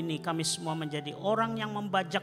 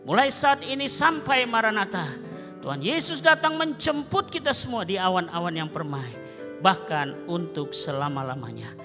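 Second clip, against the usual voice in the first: proclaimed blessing; 6 semitones higher, 6 dB louder than usual. Real, not acted. Benediction proclaimed.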